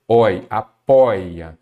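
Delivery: teacher spelling out oi in apoia; slow stressed example. Shown as a demonstration. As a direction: stretch out the oi in apoia for students. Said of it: "O ditongo aberto".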